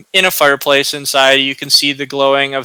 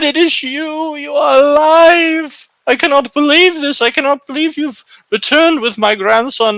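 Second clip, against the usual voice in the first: put-on overjoyed old woman, high-pitched wail; crying for joy; 11 semitones higher than usual. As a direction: cry out in high-voiced, tearful disbelief. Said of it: "begins crying, and she says".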